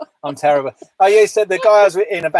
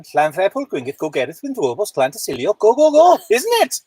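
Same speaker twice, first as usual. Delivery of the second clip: mock Welsh. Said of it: "here we go then".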